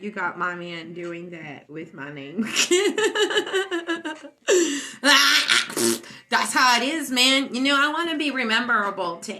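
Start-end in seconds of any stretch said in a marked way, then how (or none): 2.5-6.2 long, loud, high laugh. Real, not acted. Streamer laughing after a shout-out to a friend, loud laughter. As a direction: burst out in a high cackle.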